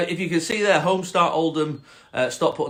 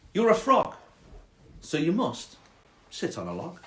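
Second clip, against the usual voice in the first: snobby cat; 3 semitones higher than usual. Acted. Cat telling the frog the rule, snobby and deliberate. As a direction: lay down the rule snobbily, with pauses. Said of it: "quite a snobby cat".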